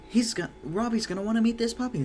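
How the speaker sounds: put-on girl at once thinks of her robot; excited little girl. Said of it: "It's like, gasps".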